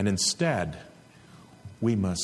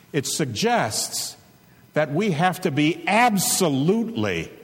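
full-force preaching; 7 semitones higher than usual. Acted. Pastor urging the flock to ruthless war on sin, fiery preaching. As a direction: drive it home from the pulpit.

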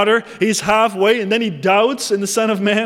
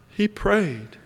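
ordinary delivery

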